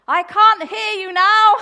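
very loud, high, slow yell; imitated deaf shouting. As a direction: bellow as if you can't hear yourself. Imitating deaf lady without hearing aid.

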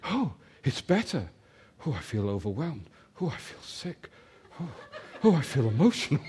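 a hushed, breathless imitation of the woman; imitating the overwhelmed woman. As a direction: she can barely get the words out. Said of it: "She said".

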